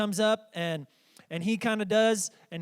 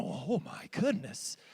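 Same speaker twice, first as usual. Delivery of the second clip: awed parents; 4 semitones lower, 7 dB quieter, slower than usual. Retold or imitated. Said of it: "every parent that was there".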